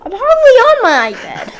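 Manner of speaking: high, loud protest; indignant shout. Real, not acted. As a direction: yell it back, offended. Denying being an iPad kid.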